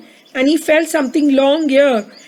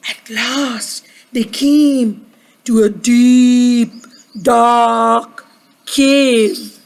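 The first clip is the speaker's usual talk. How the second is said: slow, drawn-out "deep, dark cave"; ominous storytelling; 3 semitones lower, much slower than usual. A narrator's reading. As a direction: stretch each word for suspense. Suspense on reaching the cave.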